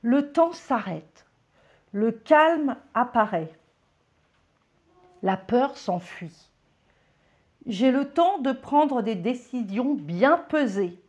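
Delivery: incantation. Shown as a demonstration. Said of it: "Et tu dis".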